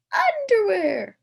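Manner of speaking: high, loud and drawn out; shouted. A narrator's reading. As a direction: stretch the word out as a gleeful shout. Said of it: "someone shouted".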